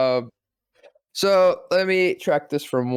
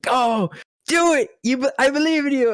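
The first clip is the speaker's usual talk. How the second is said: hyped agent; 12 semitones higher, 3 dB louder than usual. Retold or imitated. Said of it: "agent is just like".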